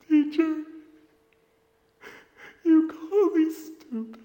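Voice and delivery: high, tearful, slow student voice; crying student. Retold or imitated. Student telling his teacher he was hurt, high and tearful. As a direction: plead through tears, voice breaking. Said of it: "And he goes".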